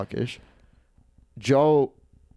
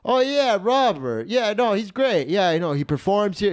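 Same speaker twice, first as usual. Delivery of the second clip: high-pitched, over-enthusiastic bluffing on the phone; Joe; 8 semitones higher than usual. Retold or imitated. Joe bluffing to a teacher about Robert, high and effusive. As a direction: gush in a much higher voice, drawing out the praise. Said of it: "I can hear Joe go".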